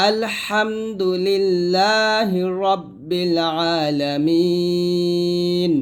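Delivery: slow chant, long held close; drawn-out tajweed recitation; recitation with a stop. Shown as a demonstration. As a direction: recite and stop on a stretched last syllable. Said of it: "আর ওয়াকফ ধরলে".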